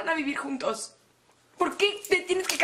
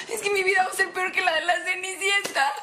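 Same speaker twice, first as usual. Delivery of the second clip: sobbing. Acted. Crying to friends about her father's wedding. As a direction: break down over your ruined life.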